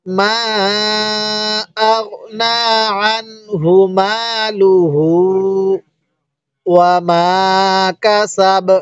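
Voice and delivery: slow, measured tajweed chant with long held vowels; Quran recitation. Shown as a demonstration. Teacher reciting the sura for learners to hear, slow tajweed chant. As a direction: recite slowly, stretching vowels and nasal sounds. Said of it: "একবার revision দিব".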